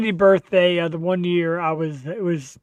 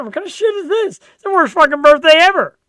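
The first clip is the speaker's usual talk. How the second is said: building from high disbelief to a loud ranting complaint; mock outrage; 10 semitones higher, 7 dB louder than usual. Husband retelling his birthday gripe to the host, mock fury. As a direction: rant it, getting louder at the end. Retold frustrated outburst on birthday.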